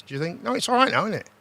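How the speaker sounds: ordinary delivery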